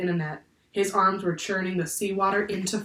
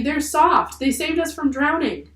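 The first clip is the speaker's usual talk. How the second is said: high-pitched, insistent protest; crying out; 9 semitones higher, 4 dB louder than usual. A narrator's reading. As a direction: plead his case in a raised voice. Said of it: "cried Annie".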